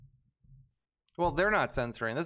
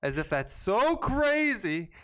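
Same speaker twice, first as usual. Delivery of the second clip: high, drawn-out sarcastic disbelief; sarcastically incredulous, pitched up; mock outrage; 6 semitones higher, 3 dB louder, slower than usual. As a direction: stretch it out in mocking amazement. Sarcastic jab at the article.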